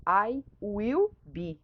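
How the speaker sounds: very slow, every word separated; slow full form. Shown as a demonstration. Teacher unpacks the contraction for students, very slowly. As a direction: draw out I will be word by word. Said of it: "Esse I'll be é".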